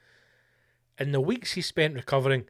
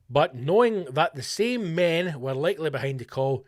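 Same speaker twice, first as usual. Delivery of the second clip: ordinary talk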